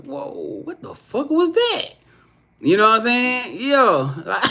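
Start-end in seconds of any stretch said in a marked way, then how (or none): 0.0-1.9 very high, strained "whoa"; mock shocked double take. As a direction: gasp it out like you can't believe it. Acted quote of shocked reaction.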